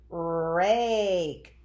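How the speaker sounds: RAKE with the A held long and strong; stretched long A. Shown as a demonstration. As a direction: draw rake way out on the vowel. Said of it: "It's stretching out nice and long".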